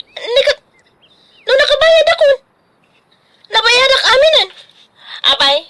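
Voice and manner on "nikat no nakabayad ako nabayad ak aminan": high, startled protest of having paid; indignant surprise. Acted. Daughter high and indignant, denying the debt. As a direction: insist she already paid it all. Daughter denies owing tuition.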